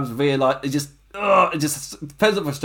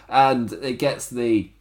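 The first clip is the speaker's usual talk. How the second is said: ordinary talk, nothing marked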